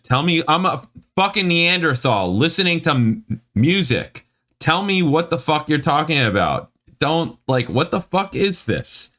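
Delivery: high-pitched, exasperated ranting; mad rant. Real, not acted. Reader rants at the review's writer, mad and baffled. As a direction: plead for sense, voice way up. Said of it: "this makes me mad".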